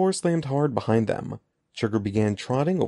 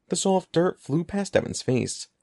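plain reading voice

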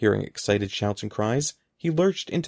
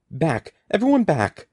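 high, loud panicked order; shrieking; 5 semitones higher, 5 dB louder than usual. A narrator's reading. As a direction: build to a high shout. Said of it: "Mister Murphy shrieked".

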